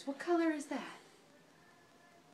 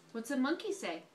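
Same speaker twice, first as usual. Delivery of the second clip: normal talking voice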